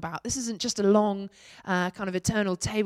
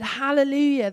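higher, louder ringing hallelujah; shouted hallelujah; 6 semitones higher, 6 dB louder than usual. A narrator's reading. Voicing the heavenly crowd's cry, a raised shout. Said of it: "And again they shouted".

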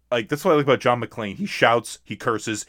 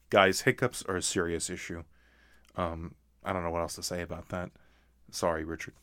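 hushed mock seriousness; 4 semitones lower, 11 dB quieter than usual.

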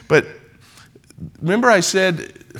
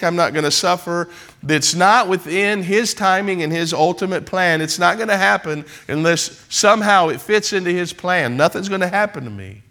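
normal talking voice

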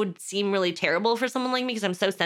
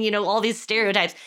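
ordinary delivery

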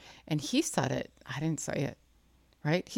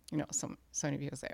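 normal talking voice

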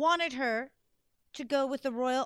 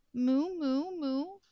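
soft moo; 3 dB quieter, slower than usual. Acted. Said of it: "who could only say".

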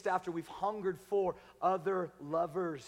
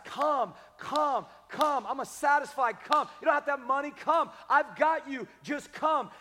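2 repeated pleading call; 8 semitones higher, 6 dB louder than usual. Retold or imitated. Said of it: "Four times".

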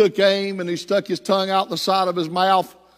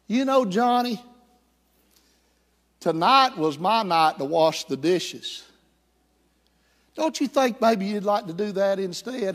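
sly little sister. Acted. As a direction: coax him sweetly, a hint of a threat. Sister sly blackmail of brother.

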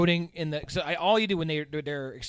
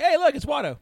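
higher, louder mock-excited call-out; mock casual discovery; 11 semitones higher, 6 dB louder than usual. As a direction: play spotting someone you know, pitched up. Acted quote of characters spotting Watto.

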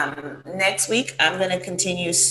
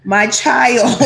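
loud, higher-pitched mock maternal wail; horrified mother; 5 semitones higher, 8 dB louder than usual. Co-host imagining his mother hearing the story, mock horror. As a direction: imitate an overdramatic mother crying out. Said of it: "She's probably like".

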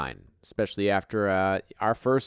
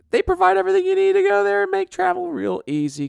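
much higher, put-on ad-read voice; silly high ad voice; 8 dB louder, faster than usual. Reading the sponsor's van ad, in a silly high pitchman voice.

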